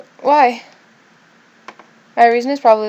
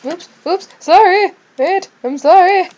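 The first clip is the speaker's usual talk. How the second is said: high, startled, louder sorry; real startled apology, pitched up; flustered apology; 9 semitones higher, 7 dB louder, slower than usual. Real, not acted. Player flustered by game event.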